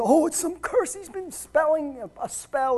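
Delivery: mock superstitious worry. Preacher mimicking listeners who misunderstand curses, mock superstitious worry.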